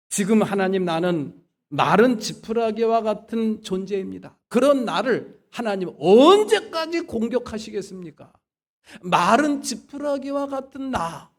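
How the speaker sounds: loud, high, impassioned protest; acted outburst of a suffering man in prayer; angry lament to God. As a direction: plead and accuse at once, at preaching force. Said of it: "승질을 내면서 저주를 퍼붓더니".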